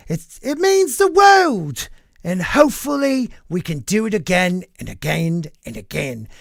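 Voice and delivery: a hammed-up mock English accent, gushing; Tom Holland accent. Podcaster quoting the actor's speech to fans, in a hammed-up English accent. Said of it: "let me try to do my accent".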